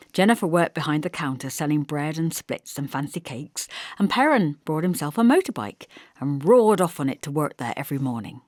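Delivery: ordinary delivery, nothing marked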